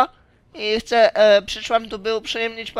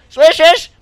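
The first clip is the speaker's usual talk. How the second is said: "Słyszysz?" called louder with a rise; 6 semitones higher, 8 dB louder than usual. Shown as a demonstration. Asking the crowd if they hear it, louder and rising.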